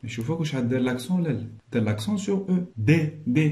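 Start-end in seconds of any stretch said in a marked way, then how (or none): 2.8-3.5 "دي" said twice as a separate clear syllable; sounded-out syllable. Shown as a demonstration. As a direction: isolate "دي" so the é is heard. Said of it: "غير من خلال النطق". ("دي" مقطّعة وواضحة)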